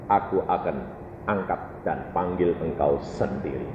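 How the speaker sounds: low, hushed, weighty voice; solemn divine promise. Retold or imitated. God promising to call the believer, solemn and low. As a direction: promise softly and deeply.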